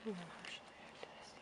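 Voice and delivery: fast, hushed whisper; quick whisper. Real, not acted. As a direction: rush it out in a whisper. Pony sniffing the camera.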